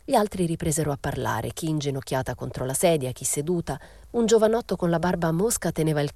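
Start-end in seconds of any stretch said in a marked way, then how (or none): none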